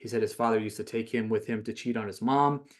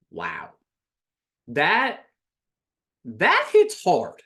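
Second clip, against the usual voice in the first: stunned; 6 semitones higher, 8 dB louder, much slower than usual. Real, not acted. Stunned reaction to his words.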